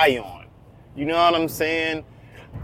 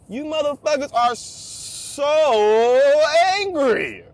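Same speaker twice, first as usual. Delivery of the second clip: exasperated; 8 semitones higher, much slower than usual. Real, not acted. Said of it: "exasperated complaint".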